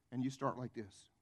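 usual speaking voice

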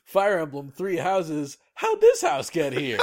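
big trailer voice jumping to a squeal; hype announcer. Pretend. Mock announcement building up.

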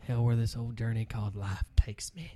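whispered wistful aside. Real, not acted. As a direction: hush it out dreamily. Wistful aside about life.

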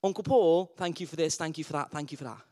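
soft, low, repetitive rote thanks; mumbled child's thanks, fading away; dutiful little nephew. Retold or imitated. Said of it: "He came around and he said".

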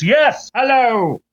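hammed-up pompous Englishman; British accent. Author on the news, in a mock British accent. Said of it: "Now he's British".

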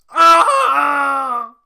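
dragon roar. Acted. Dragon lets out mighty roar.

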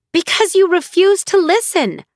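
high, loud furious retort; fuming. Acted. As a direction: throw it at her mother, high and hot. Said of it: "Nahal fumed".

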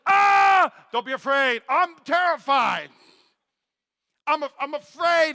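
high, loud mock-frightened yelp; hammed-up terror. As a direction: play Daniel trembling before the angel, half joking. Daniel answers the angel, terrified.

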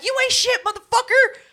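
high, loud yelled insult; retold furious shout; angry yell. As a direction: scream it at him in fury. Furious retold quote at brother.

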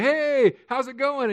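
high, put-on friendly hello; mock cheery greeting. Pastor imitates people greeting each other, mock cheery. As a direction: greet them with exaggerated cheer.

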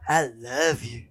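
low, hammed-up "I love you"; mock imitation of confession. Teasing a friend for how she said it, low mock-earnest. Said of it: "The way you said it".

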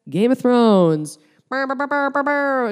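high loud brr brr buzz; mocking brr noise. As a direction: buzz like a silly fanfare. Mocking theme hum.

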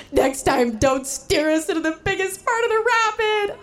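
tearful, very high and breaking voice; crying. Retold or imitated. To husband after the rapid, crying in tears. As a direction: sob the words out. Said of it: "I burst into tears".

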